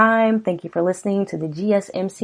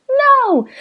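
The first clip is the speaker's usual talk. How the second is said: much higher, pleading wife voice, slow; voiced frightened wife; 7 dB louder than usual. Host reading lyrics gives the wife a high, frightened plea. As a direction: pitch way up as the scared wife.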